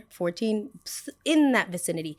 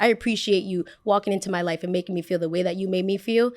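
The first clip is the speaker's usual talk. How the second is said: ordinary delivery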